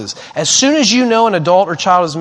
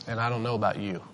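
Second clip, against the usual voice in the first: low, very soft aside; dropped right down in pitch and volume; understated retold judgement; 8 semitones lower, 14 dB quieter, faster than usual. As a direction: quote the stranger quietly and low. Quotes stranger's blunt remark.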